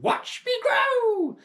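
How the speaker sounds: slow, very high, loud crone yell; drawn-out shout. Acted. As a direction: stretch every word as she shoots up. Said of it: "She shouted".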